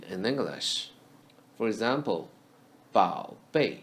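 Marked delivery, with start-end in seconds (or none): 2.9-3.8 bao bei, bao in the third tone; Mandarin pronunciation. Shown as a demonstration. Teacher modelling Mandarin bao bei for learners. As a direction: say bao bei slowly with clear Mandarin tones. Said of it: "Bao is read as the first syllable".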